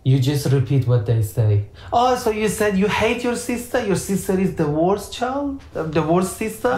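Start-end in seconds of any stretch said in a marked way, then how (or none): 1.9-6.7 gentle validating. Shown as a demonstration. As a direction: echo the child back gently, pitched higher. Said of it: "validation doesn't mean you agree with them".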